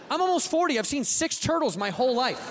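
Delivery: ordinary talk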